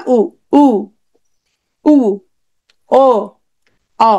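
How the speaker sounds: উ, ও, অ sounded singly with tongue drawn back; back vowel demo. Shown as a demonstration. Teacher shows the tongue moving back, demonstrating back vowels উ, ও, অ. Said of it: "জিভ পেছনে চলে গেছে".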